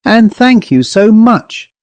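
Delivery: high bright goodbye, forced; a mild hint of forced cheer calling goodbye; falsely cheerful call. A narrator's reading. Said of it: "she called out with false cheerfulness".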